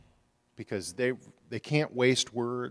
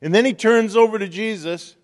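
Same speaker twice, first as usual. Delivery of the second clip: higher and louder, driving the story home; fiery preaching; 7 semitones higher, 12 dB louder than usual. Acted. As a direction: preach it at full force.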